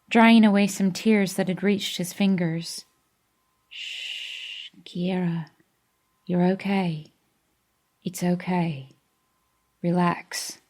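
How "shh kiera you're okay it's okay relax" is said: soft whispered reassurance; soothing hush. Acted. Denny calms Kiera in the hospital, softly whispering. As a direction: hush her gently.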